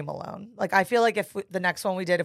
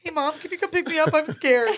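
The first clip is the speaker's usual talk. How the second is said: put-on scared kid voice; 9 semitones higher, 3 dB louder than usual. Mock call to mom.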